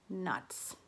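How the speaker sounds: whispered, deflated nuts; defeated sigh. Real, not acted. Sighing after bad tally.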